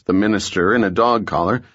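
plain reading voice

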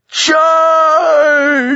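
very high piercing battle shriek. Acted. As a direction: screech the order to attack.

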